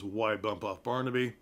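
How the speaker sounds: ordinary talk, nothing marked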